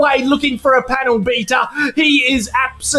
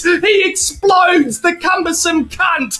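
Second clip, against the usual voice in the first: ordinary talk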